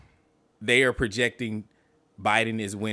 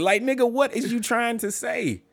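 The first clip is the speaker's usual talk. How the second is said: exasperated demand; 12 semitones higher than usual. Retold or imitated. Exasperated retort to rambling debater.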